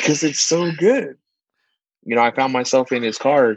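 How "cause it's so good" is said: savoring it. Retold or imitated. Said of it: "I was like".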